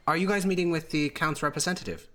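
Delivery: a hint of a bright helpful hostess; young woman. Servant greeting the party in the conference room, a lightly voiced polite young woman.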